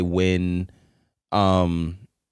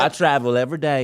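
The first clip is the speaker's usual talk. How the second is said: put-on Southern accent; 5 semitones higher than usual. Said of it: "Southern accents".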